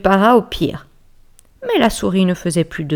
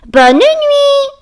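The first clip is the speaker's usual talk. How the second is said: very high, louder squeaky goodnight; 11 dB louder than usual. Acted. Mouse repeating goodnight to the sleeping bear, louder and very high. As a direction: sing out goodnight a bit louder. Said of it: "répéta la souris, un peu plus fort".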